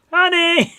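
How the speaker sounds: high, loud call. Pretend. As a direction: call her across the house. Calling out to wife.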